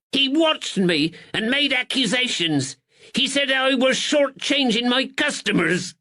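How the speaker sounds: high, pleading northern shopkeeper; cornered man whining his innocence; frightened draper. Acted. Nervous draper pleads with the constable in a high voice. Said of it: "Thatcher's voice rose plaintively".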